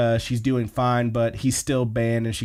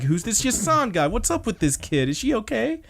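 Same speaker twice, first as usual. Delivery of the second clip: higher, half-mocking alarmed concern; alarmed questions; 10 semitones higher than usual. Hitomi questioning the girl, alarmed and higher. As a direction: pitch up as if startled by the kid.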